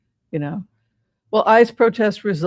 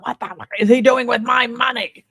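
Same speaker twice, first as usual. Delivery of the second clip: angry donors; 5 semitones higher than usual. Retold or imitated. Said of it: "They're extremely angry about".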